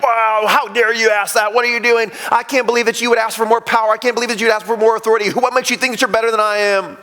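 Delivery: high-pitched, rapid-fire outraged protest; frustrated indignation. Acted. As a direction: act out indignant disbelief, piling up the accusations. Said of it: "they're frustrated".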